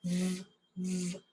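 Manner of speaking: "V, v" as two held buzzes, soft; isolated V sound. Shown as a demonstration. Teacher demonstrating the V sound, held buzzing "v". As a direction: hold the buzz of "v" alone, no letter name. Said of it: "V makes a V sound".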